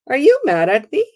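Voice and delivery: bright, high, gently teasing ask; friendly smiling question. Retold or imitated. Asking the sandwich clerk with a smile, lightly. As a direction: smile it out, disarming.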